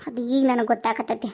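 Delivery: ordinary talk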